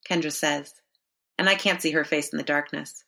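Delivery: ordinary delivery, nothing marked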